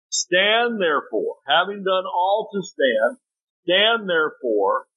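slow, high, deliberate scripture reading; emphatic scripture reading. Acted. Preacher to congregation, slow emphatic scripture reading. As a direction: lean on every word of the verse, slowly.

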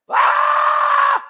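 high piercing preacher's yelp; sharp shout. Real, not acted. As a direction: let out a sudden high cry. Preacher's shout mid-sermon.

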